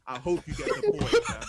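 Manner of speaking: mocking the rival fan, laughing jeer. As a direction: laugh through the insult. Repeats insult, laughing.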